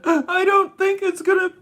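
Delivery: high, teary, sobbing self-quote; high crying voice, replaying the breakdown; retold crying. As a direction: wail it like you were crying then. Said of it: "I started crying at seven".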